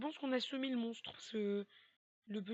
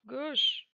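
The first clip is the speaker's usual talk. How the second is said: loud, higher called direction; 4 semitones higher, 11 dB louder than usual. Real, not acted. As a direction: bark the turn urgently. Navigating maze in panic.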